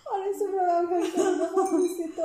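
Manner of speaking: high, loud and fast; excited. Real, not acted. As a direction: blurt it out as the heat hits. Reacting to spicy heat.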